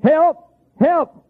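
high-pitched imitated distress call; hollered cry for help. Preacher retelling a stranger lost in dark wilderness, high holler. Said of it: "I heard somebody hollering".

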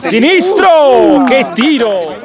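high, slow shout of the shot; excited shot call. Acted. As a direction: drag out the word. Calls a shot in play-by-play. (telecronaca concitata)

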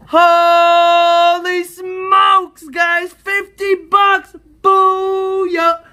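loud, high, stretched-out whooping; ecstatic shout. Real, not acted. Scratcher shows viewers fifty bucks, ecstatic shouting. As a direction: yell in triumph, long and high.

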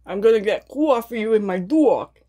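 hammed-up New York accent, long rounded vowels; exaggerated New York "cwoffee" and "dwawg". Shown as a demonstration. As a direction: stretch coffee and dog like a New Yorker. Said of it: "I love the New York accent".

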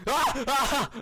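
two short high screams; startled scream. Real, not acted. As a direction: yelp in sudden fright. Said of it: "I just freaked out".

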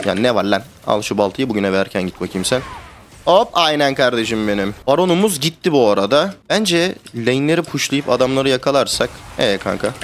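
ordinary delivery

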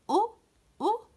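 short "أو" sounded alone, twice; letter sound drill. Shown as a demonstration. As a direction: isolate the opening vowel "أو", cut short. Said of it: "منتبها إلى صوت الحرف".

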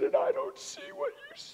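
very high, sobbing wail; crying pitifully. Retold or imitated. Son falling on his father's chest, high and tearful. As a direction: wail it through tears. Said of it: "he cried pitifully".

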